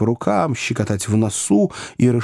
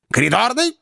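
curt summons; 8 semitones higher than usual. A narrator's reading. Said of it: "сказал он".

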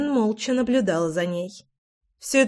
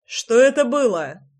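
shouting; 3 semitones higher than usual. A narrator's reading. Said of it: "закричал он".

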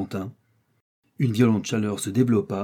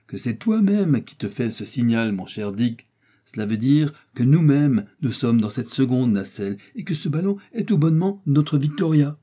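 plain reading voice

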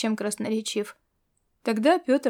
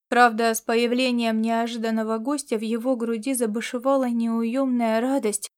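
plain reading voice

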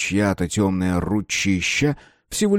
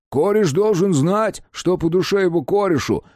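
proclaiming loudly; 10 semitones higher than usual. A narrator's reading. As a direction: announce it like a boastful buddy. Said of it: "провозглашает Джо".

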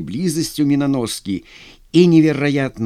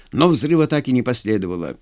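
ordinary delivery, nothing marked

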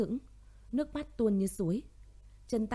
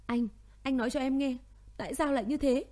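wailing; 5 semitones higher than usual. Acted. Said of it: "Tuệ Lâm khóc lóc thảm thiết".

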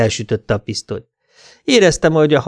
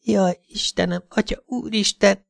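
high, slow, acted moan; moaning woman; 10 semitones higher, 4 dB quieter than usual. A narrator's reading. Cindy moans in bed with her lover, high moaning. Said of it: "nyögte Cindy".

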